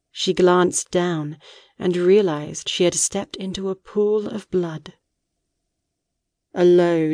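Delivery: ordinary delivery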